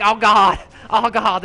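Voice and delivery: high, loud mock dread; frantic comic wail; retold panic. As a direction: cry out in hammed-up alarm. Still panicking about the axe.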